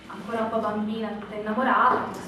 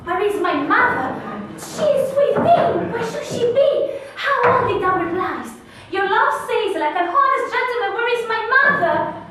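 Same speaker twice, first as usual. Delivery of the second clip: much higher and louder, exasperated cry at the nurse; exasperated outburst; 14 semitones higher, 12 dB louder than usual. Acted. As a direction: throw her own words back at her in frustration.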